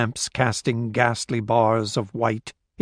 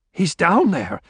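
higher-pitched, insistent protest; raised voice; 6 semitones higher than usual. A narrator's reading. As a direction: raise your voice to insist, short of shouting. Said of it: "Esterhazy raised his voice".

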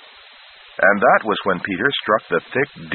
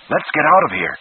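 urgent; 7 semitones higher than usual. Acted. Urgent escape call.

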